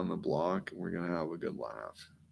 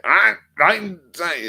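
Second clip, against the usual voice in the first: high, very loud burst; mock German shout; 13 semitones higher, 16 dB louder than usual. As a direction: start a hammed-up shouted rant. Starts mock German ranting, breaks off.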